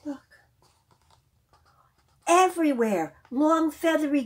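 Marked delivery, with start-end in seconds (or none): 0.1-0.4 gasp, then whisper it; breathless hushed wonder; a storyteller gasps and shows the children; awed whisper. Acted.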